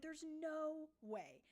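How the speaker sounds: slow, high disbelief. Retold or imitated. Streamer recalling her own shock at the time taken. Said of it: "I was like".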